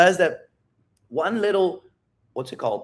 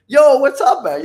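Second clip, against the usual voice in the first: loud, high "yo what's up" with a laugh; buddy spotted across the street, loud and laughing; hyped laughing greeting; 10 semitones higher, 10 dB louder than usual. Retold or imitated. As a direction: greet your best friend with a big laughing shout.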